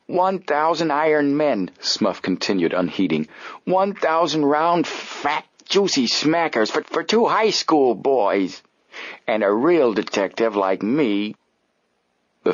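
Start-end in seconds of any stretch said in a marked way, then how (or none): 0.1-1.7 slow, high relishing words; gloating disbelief. Acted. Smuff gloating. 3.6-11.3 slow, high aggrieved savouring; gloating lament. Acted. Smuff gloating and groaning.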